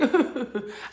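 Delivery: high giggle; mock laugh. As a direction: let a short imitated laugh out. Mimics the laughing meditation.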